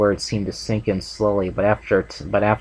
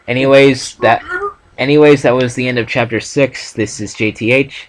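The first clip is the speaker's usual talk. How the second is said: loud outro; 4 semitones higher, 8 dB louder than usual. Real, not acted.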